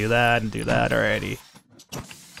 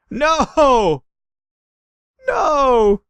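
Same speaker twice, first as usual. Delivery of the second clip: high, loud cry of dismay; startled shout of no; real shout; 11 semitones higher, 5 dB louder than usual. Real, not acted. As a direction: yell no as it all goes wrong. Colonists burning, streamer reacts.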